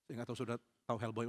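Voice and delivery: ordinary talk, nothing marked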